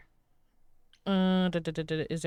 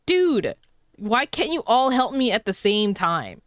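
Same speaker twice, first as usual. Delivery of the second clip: exasperated retold complaint; 4 semitones higher, 7 dB louder, slower than usual. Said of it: "And I'm like".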